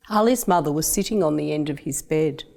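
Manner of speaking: ordinary delivery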